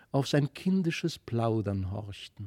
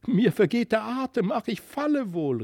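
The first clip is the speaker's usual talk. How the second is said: frightened cry; 8 semitones higher, 3 dB louder than usual. A narrator's reading. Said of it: "rief sie".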